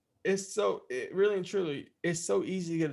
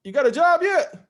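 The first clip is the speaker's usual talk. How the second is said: higher, louder needling question; loud dad on phone; 5 semitones higher, 4 dB louder than usual. Retold or imitated. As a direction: poke at him down the phone. Dad asking nephew on phone.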